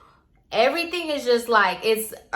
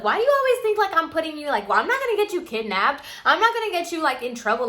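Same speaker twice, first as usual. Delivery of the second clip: higher, exasperated friend brushing off worries; mocking the friend; 8 semitones higher, much faster than usual. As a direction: mimic her dismissive voice, pitched up. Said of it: "She's like".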